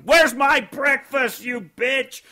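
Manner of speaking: high, loud, drawn-out bellow; hammed-up drunk husband hollering for food; mock angry yell. Husband yelling at his wife for breakfast, mock angry shout.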